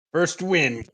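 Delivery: loud, high triumphant call; excited. Real, not acted. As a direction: call it out proudly. Announcing first win.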